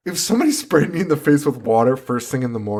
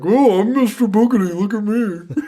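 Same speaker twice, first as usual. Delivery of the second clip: high, louder hammed-up dad; goofy dad voice; 9 semitones higher, 4 dB louder than usual. Dad teasing his daughter, high loud goofy voice. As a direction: play the dad clowning for his kids. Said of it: "he's just like".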